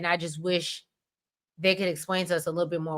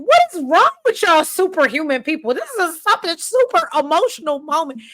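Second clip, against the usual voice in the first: pitched way up and louder, incredulous; mock exasperated disbelief; 10 semitones higher, 5 dB louder than usual. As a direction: act baffled that nobody is crying. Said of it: "and I was like".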